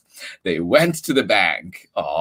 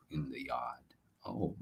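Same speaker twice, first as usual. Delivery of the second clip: whisper; 9 semitones lower, 20 dB quieter than usual. Acted. Reader whispers to listening children.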